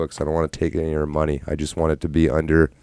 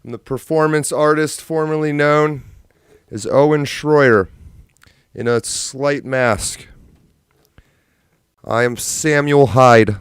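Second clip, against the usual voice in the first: much higher, louder and slow pompous announcement; grand mock introduction; 9 semitones higher, 6 dB louder, slower than usual. Streamer introducing a comedy persona, pompous. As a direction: introduce yourself like a pompous showman. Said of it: "comic self-introduction as persona".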